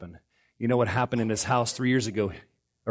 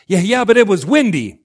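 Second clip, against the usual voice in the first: loud, higher protest as Peter; defensive excuse; 5 semitones higher, 8 dB louder than usual. Acted. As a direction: blurt a mock excuse. Acts Peter making excuses.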